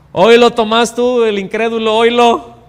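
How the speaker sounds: loud, high sarcastic jeer; mocking taunt. Preacher imagines the Lord jeering at doubting Thomas, loud and mocking. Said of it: "no le puso una mirada sarcástica".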